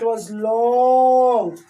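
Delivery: long slow stretch on long; drawn out. A narrator's reading. Telling children how long the nose grew, drawn out. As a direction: stretch the word long out slowly.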